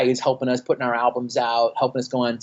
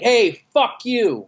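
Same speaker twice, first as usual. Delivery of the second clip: higher, slow, drawn-out taunt; confrontational taunt; 9 semitones higher, 3 dB louder, much slower than usual. Retold or imitated. Singer taunting the audience from stage, slow and sneering. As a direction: sneer it at the crowd. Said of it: "get on stage and just be like".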